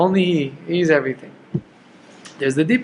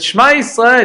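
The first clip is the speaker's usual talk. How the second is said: raised, emphatic quoted cry; quoting a declaration; 8 semitones higher, 5 dB louder than usual. Acted. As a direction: call it out higher and louder. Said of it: "But he said".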